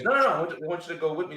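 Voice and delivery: mock a quick, emphatic no; insistent correction. Wife's insistent correction retold.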